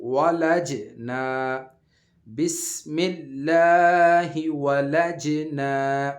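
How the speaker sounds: بسم اللہ ولج نا drawn out, ج bounced; slow joined recitation. Shown as a demonstration. Teacher reciting the joined phrase for the class, slow and bounced. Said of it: "اس کو ہم ملا کے پڑھتے ہیں،".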